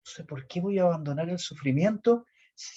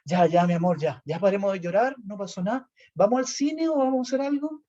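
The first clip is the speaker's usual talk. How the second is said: higher, bright switch from tears to plans; mock-breezy turnaround; sudden cheerful recovery; 6 semitones higher than usual. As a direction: act over it in a second. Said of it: "Se le pasaba al tiro la angustia".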